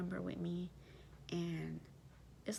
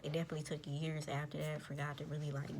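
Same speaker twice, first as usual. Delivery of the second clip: ordinary delivery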